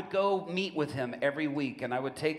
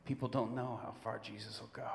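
quiet confession; 3 semitones lower, 7 dB quieter than usual. Retold or imitated. Prisoner confides quietly.

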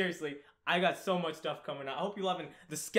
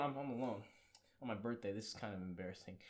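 mock sad mumble; 7 semitones lower, 11 dB quieter than usual. Mock sad birthday confession.

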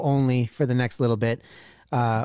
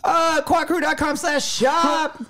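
panicked; 11 semitones higher than usual. Real, not acted. Ad playing, reading the ad.

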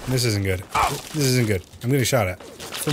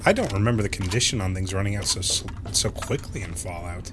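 ordinary delivery

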